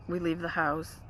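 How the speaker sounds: normal talking voice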